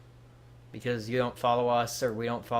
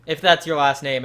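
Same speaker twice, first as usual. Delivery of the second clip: louder, bursting into laughter; laughing; 2 semitones higher, 10 dB louder than usual. Real, not acted. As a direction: laugh it out loud. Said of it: "I'm trying not to laugh".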